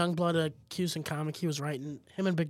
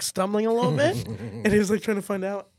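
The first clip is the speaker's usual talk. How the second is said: usual speaking voice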